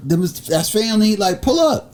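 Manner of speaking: high excited reply. Retold or imitated. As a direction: climb up high with joy. Retelling own excited reply.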